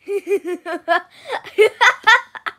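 high loud laugh; burst of laughter. Real, not acted. Reader cracking up at the silly rhyme.

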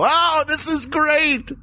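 slow, high, drawn-out delight; delighted crowd. Retold or imitated. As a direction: stretch it out high like a charmed audience member. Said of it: "And they'd go".

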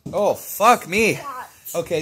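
in pain. Real, not acted. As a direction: drag it out as the burn hits. Spicy candy burning.

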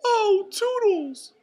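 high, loud, drawn-out falsetto; Mickey Mouse calling. A narrator's reading. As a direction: summon a helper in a long mouse call. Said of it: "cried Mickey".

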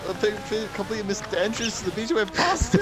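high, rushed words on the edge of hysterics; laughing to tears. Real, not acted. Gamer reliving a missed beach entrance for friends, laughing to tears. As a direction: retell the blunder through real giggles. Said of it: "I'm in tears".

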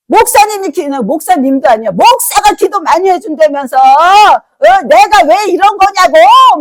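angry yelling. Retold or imitated. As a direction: throw the caller's rage back at them. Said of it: "얼마나 화가 나고". (화난 신도가 목사에게 소리치듯 따지는)

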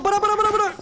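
high, frantic repeated pleas; panicked. Real, not acted. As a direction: beg it to stop, panicking. Panic during chase.

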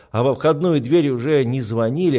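ordinary delivery, nothing marked

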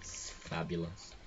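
low, soft, very slow word; understated drawl. Pretend. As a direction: draw it out quietly. Playing with one word.

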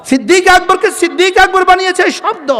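ordinary talk, nothing marked

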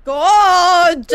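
slow, loud startled cry; loud shout. Real, not acted. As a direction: yell out in shock. Big shout after a scare.

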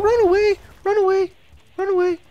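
squeaky high "Run away!"; girly voice. Shown as a demonstration. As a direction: shriek it high like a frightened girl. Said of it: "Not girly voice".

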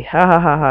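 mock laugh. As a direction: laugh out loud at your own joke. Mock laugh at own joke.

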